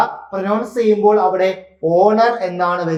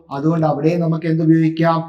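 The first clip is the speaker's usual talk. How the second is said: usual speaking voice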